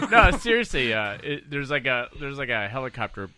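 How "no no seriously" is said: excited insistence. Real, not acted. Insisting after laughing.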